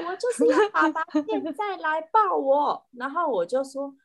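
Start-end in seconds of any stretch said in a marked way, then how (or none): 0.1-2.8 higher, insistent bratty whine; spoiled cat. Retold or imitated. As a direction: demand the hug like a pampered cat. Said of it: "他就说". (任性撒娇的猫咪声音)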